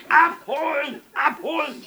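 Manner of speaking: high, very loud repeated yell; piercingly high, furious bellow; shouted order. A narrator's reading. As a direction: yell the command at full voice. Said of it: "rief der Bürgermeister".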